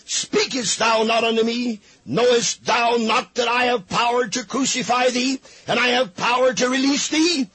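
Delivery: angry shout. Acted. Pilate shouting at Jesus about his power to crucify, angry. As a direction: bellow it as a threatened governor.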